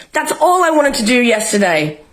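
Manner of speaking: normal talking voice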